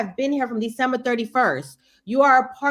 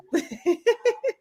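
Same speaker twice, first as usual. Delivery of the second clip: high laugh; 9 semitones higher than usual. Real, not acted. Laughing after reassuring friend.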